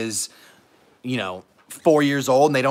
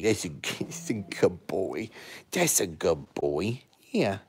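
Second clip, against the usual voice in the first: ordinary delivery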